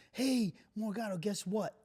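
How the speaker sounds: imitating a sergeant breaking happy news; feigned good news.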